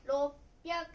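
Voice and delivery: 六百 read "rop-pyaku", stopped before the p; roppyaku. Shown as a demonstration. Teacher counting hundreds for learners, roppyaku with a held stop. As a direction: cut 六 to "rop", then "pyaku". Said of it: "発音は六百ですね".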